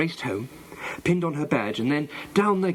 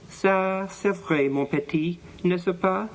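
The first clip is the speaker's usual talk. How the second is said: higher-pitched French aside; speaking French; 6 semitones higher, slower than usual. A narrator's reading. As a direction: turn to the French boy in lilting French. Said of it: "she added in French".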